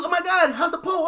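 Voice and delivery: high-pitched alarmed wail, acted out; mock panic. Flustered woman reacting to car damage, mock panic.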